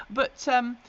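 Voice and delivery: ordinary delivery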